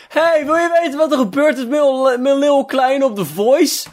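very high-pitched smart speaker announcing gossip; sustained high, sing-song notification voice; chirpy assistant voice. Acted. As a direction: pipe up high like an intrusive news alert. Acts out a Google Home trend alert.

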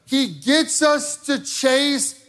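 high, loud and driving, building to a shout; fiery preaching. Acted. Pastor rousing the congregation against the enemy, fiery shouting preach. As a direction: hammer it home to the congregation.

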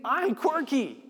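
high, slow, put-on voice for Jesus saying it; playful Jesus voice. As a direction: ham up Jesus owning his oddness.

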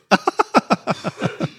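single rough cough; chesty cough. Real, not acted. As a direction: cough into the mic. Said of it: "Sorry, I've got a cough".

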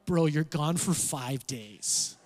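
mild mock of a skeptical friend; unimpressed buddy. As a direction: drag it out like a bored bro. Friends react to over-the-top planning.

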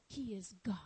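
low, whispered reverence; whispered, awestruck declaration; hushed awe. Real, not acted. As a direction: let it drop to a reverent whisper. Hushed awe closing the definition.

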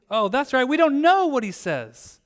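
high, loud burst of mock recall; feigned sudden realization. Preacher pretending to remember there's no ending, mock realization. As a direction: exclaim as if it just hit you.

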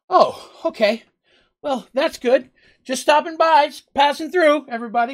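high, loud, eager innkeeper voice, slowing on passing through; the GM as the innkeeper, letting out a relieved, high-pitched gush. Acted.

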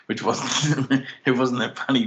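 high-pitched, giggly words broken by laughter; laughing. Real, not acted. Podcaster explaining a microphone bump, laughing. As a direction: try to explain through helpless giggles. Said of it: "So I'm laughing".

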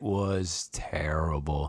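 slow disgusted whisper. Real, not acted. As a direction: breathe it out with disdain. Mocking bad sword fights.

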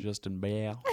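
put-on Southern-accented Justin Beal. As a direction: say the name with a Southern twang. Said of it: "You said that in a really southern accent".

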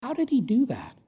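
soft, breathy whisper of wonder; whispered amazement at a son's loyalty; awed whisper. Real, not acted. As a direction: hush it like a secret marvel.

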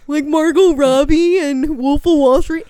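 loud, high, slow hammed-up Australian; over-the-top Australian mate voice; mock Aussie accent. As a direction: bellow it like a cartoon Aussie. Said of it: "they would say".

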